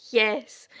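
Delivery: ordinary talk, nothing marked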